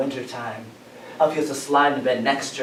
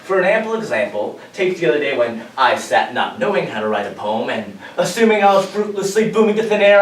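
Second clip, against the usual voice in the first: usual speaking voice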